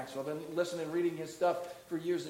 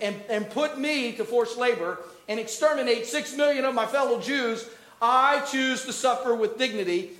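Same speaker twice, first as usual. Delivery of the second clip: raised, insistent voice building in force; impassioned resolve; 5 semitones higher, 5 dB louder than usual. Acted. Auschwitz survivor vowing to suffer with dignity, impassioned resolve. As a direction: declare it as a defiant vow.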